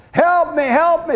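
pleading. Acted. Man begging from cliff branch.

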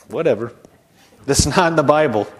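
ordinary talk, nothing marked

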